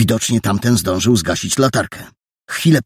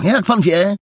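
put-on high, fast, indignant boyish protest; a boy angrily demanding the stranger let him go, high and fast; 11 semitones higher than usual. A narrator's reading. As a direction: snap back at him, hurry to be let go. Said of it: "rzekł ze złością".

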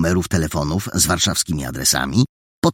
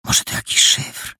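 whispered question. A narrator's reading. Perełka whispers a theory to Paragon, hushed. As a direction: hush it like a conspirator. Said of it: "wyszeptał Perełka".

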